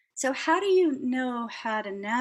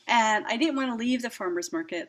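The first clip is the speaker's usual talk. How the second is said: normal talking voice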